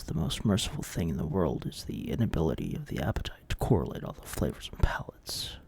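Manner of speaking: low, hushed cosmic-horror read; ominous horror narration. Acted. Host addressing the viewers, low hushed cosmic-horror narration. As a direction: lure them toward the abyss.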